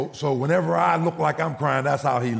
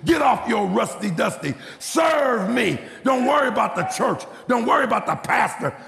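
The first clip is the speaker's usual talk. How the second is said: high, fervent preacher voicing God's command; fiery rhythmic exhortation; full-force preaching; 7 semitones higher than usual. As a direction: drive each command home, pitched up.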